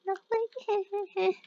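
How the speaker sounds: high, put-on little voice; mock-innocent reply. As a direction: answer the pet in a squeaky innocent voice. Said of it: "I'm like".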